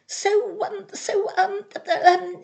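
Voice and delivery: high, halting stammer; stuttering. A narrator's reading. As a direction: stumble over every word. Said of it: "he stuttered".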